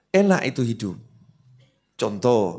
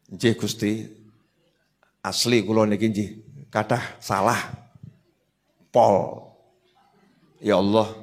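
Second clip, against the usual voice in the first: softer, lower pleading prayer in Javanese and Indonesian; humble prayer; 6 semitones lower, 4 dB quieter than usual. Shown as a demonstration. Preacher models a humble prayer to God, soft and pleading. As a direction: pray meekly, begging forgiveness. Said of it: "Ngadep Gusti Allah".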